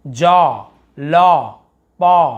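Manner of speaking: जॉ, लॉ, पॉ each with rounded "aw"; long "aw". Shown as a demonstration. Teacher reading examples, emphatic long "aw". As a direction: stretch each vowel as "aw". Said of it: "आ उच्चारण हो रहा है".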